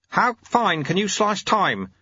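put-on slow, deliberate old man; old sweeper voice. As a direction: cryptic old teacher.

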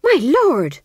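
high, loud protest; scandalised fool; shocked. A narrator's reading. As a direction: leap up in pitch, aghast. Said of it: "said the fool, really shocked".

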